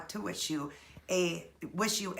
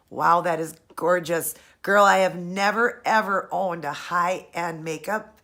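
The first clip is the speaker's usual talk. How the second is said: loud, thrilled gushing; squealing with joy over a dream present; excited; 9 dB louder than usual. Real, not acted. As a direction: burst out with delight over the gift. Thrilled over her first palette.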